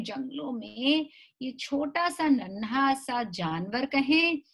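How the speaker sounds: usual speaking voice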